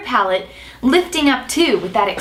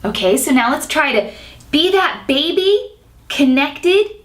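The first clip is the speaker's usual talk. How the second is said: ordinary talk, nothing marked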